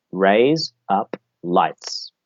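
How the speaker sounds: three separate words, very slow: "Raise up lights". Shown as a demonstration. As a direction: spell out "Raise up lights" with gaps. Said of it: "I want you both to say".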